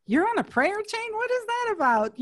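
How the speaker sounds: high, disbelieving question; incredulous surprise. Retold or imitated. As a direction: sound baffled by the news. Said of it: "I was like".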